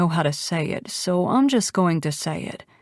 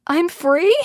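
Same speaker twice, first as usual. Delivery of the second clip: high, incredulous repeat; raised voice, astonished rising question; louder question; 10 semitones higher, 4 dB louder than usual. A narrator's reading. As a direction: ask it out loud, pitch leaping upward. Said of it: "I ask louder".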